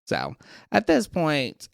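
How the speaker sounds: normal talking voice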